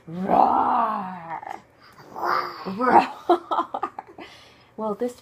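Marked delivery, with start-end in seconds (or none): 0.1-3.9 low roar into laugh; playful roar. Pretend. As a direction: roar for the child. Playful roaring with child.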